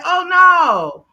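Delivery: mock alarm. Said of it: "I was like".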